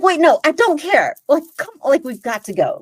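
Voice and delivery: loud, high-pitched retold protest; exasperated urging. Storyteller urging lingering friend to leave, acted exasperation. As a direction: cut in urgently, hurrying a friend along.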